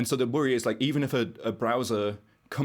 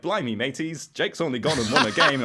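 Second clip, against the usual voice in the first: higher, louder hammed-up cockney announcer, laughing through it; mock cockney British; 6 semitones higher, 5 dB louder than usual. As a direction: play a cartoon London bloke cheering a pool win. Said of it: "the voice coming out was like".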